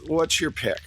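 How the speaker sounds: usual speaking voice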